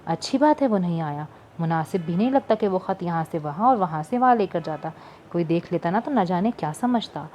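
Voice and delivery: ordinary delivery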